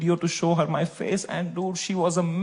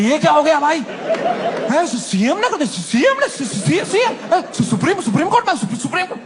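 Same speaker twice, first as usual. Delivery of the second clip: higher, fast flustered stammering; panicked stammer; 7 semitones higher than usual. Retold or imitated. Friend Tanmay panicking about the court case, flustered stammer. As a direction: stumble over words in a panic. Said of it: "उनकी गांड फट गई".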